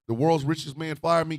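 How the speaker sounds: usual speaking voice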